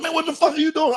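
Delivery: high-pitched comic outrage; alarmed scolding. Retold or imitated. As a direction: act out scolding a dozing passenger. Driver scolds sleepy passenger.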